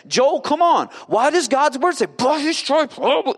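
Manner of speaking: insistent high-pitched prodding, retold; exasperated. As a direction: pester him at the end of your patience. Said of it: "now he's getting frustrated".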